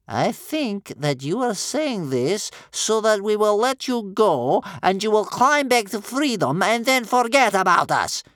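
high, loud, mounting accusation; distrustful old grandmother. Acted.

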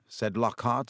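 ordinary delivery, nothing marked

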